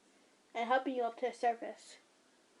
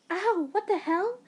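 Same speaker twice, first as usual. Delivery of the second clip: startled, pained yelp pitched up; yelping yell; 7 semitones higher, 4 dB louder, slower than usual. Acted. Tackled girl yelling out in pain. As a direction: cry out as you're tackled. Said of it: "you yelled out".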